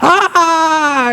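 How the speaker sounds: retold "aah" sliding down from high pitch; mock scream. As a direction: imitate a panicked scream without full volume. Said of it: "and I'm screaming, just".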